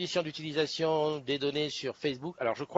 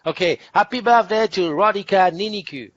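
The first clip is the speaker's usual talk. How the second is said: birthday wish in English with a French accent; French-accented English, a bit louder and higher; 6 semitones higher, 9 dB louder than usual. Real, not acted. Host greets an English-speaking viewer in French-accented English. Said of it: "Ne te moque pas de mon accent".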